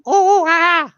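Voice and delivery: very high, loud mocking hoots; hooting giggly monkey. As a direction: hoot high and loud to tease. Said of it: "I used to always make fun of them".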